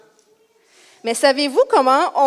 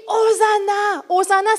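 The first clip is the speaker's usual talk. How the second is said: high, raised hosanna call; crowd cry; 7 semitones higher than usual. Acted. Crowd crying out as Jesus arrives, high joyful shout. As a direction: call it out jubilantly. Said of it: "Puis il crie".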